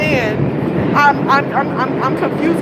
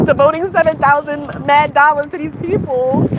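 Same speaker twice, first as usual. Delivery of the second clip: ordinary talk, nothing marked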